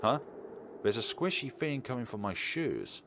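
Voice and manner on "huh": normal talking voice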